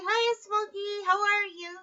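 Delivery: high, slow sing-song pet talk; baby talk to a pet. Retold or imitated. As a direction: coo at the bird. Talking sweetly to her parrot.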